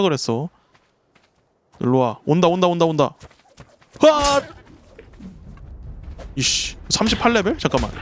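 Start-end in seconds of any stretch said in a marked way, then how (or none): 4.0-4.4 sudden high yelp of alarm; sharp high cry of fright; startled yelp. Real, not acted. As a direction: yelp as it bites you. Attacked by raptor.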